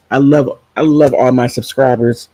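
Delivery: ordinary talk, nothing marked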